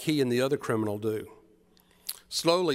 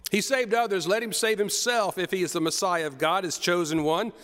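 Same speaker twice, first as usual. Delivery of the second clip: raised, jeering taunts at the cross; mocking crowd; 6 semitones higher than usual. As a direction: sneer it up high like a scornful crowd. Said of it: "the people were mocking".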